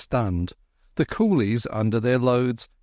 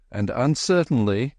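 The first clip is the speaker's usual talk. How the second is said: plain reading voice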